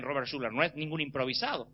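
normal talking voice

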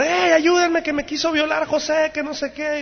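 high-pitched mock cry for help; mock screaming. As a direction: act out the wife crying out, high but not truly screamed. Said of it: "la esposa empezó a gritar". (grito fingido y agudo de auxilio)

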